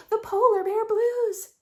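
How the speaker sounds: bright refrain. Acted. Teacher repeats the polar bear line brightly.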